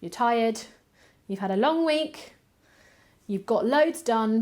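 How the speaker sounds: ordinary talk, nothing marked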